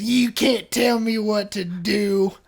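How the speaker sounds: slow, defiant mock-stubborn retort; defiant wife quote. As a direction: drag it out like a stubborn drunk refusing orders. Wife defying the police.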